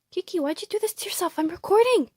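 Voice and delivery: high, quick fretting at the bird. Real, not acted. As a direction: fuss over your tangled pet. Fussing over tangled pet bird.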